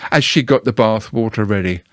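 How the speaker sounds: plain reading voice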